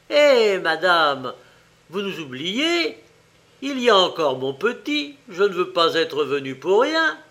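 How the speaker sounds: loud, high, indignant call; crying out in protest. A narrator's reading. Poor shopkeeper calls to the departing fairy, crying out. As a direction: cry out to be noticed, pitch swinging high. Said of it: "s'écria".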